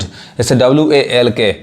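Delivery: ordinary talk, nothing marked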